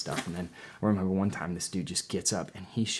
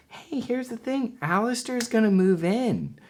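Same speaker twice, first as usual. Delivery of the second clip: much higher, slower mock girly voice; imitating a girl; 4 dB louder than usual. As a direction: lay on a sing-song girl voice. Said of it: "she's like".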